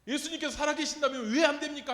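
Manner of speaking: loud, high, pleading challenge to the senior pastor; demanding protest. Retold or imitated. Young preacher confronting his senior pastor, loud demanding protest. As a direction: push back hard, voice rising to a shout. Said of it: "하면서 막 따졌어요".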